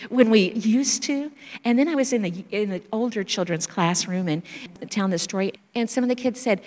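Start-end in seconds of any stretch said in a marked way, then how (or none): 0.1-1.3 "used to" slowed and weighted; stress on past tense. Shown as a demonstration. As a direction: lean on "used to" slowly, savouring it. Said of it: "Don't you love that past tense?".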